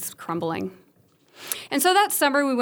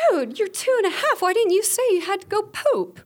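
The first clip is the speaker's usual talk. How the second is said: laughing exasperation; 10 semitones higher than usual. Retold or imitated. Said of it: "I'm like".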